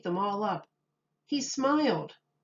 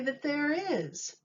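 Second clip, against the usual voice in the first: put-on high, childlike piping; a small boy's high voice; little boy voice; 7 semitones higher, slower than usual. A narrator's reading. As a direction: play the little boy, bright and sure of himself. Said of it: "said the boy".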